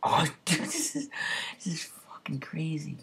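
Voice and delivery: stunned, breathy whisper. Real, not acted. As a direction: let it slip out in a whisper. Stunned by the game's difficulty.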